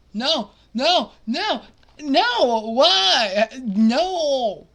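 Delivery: rising loud wailing nos, voice cracking; anguished no. Real, not acted. Gamer watching his favourite player fall, anguished wailing. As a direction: despair pouring out in protest.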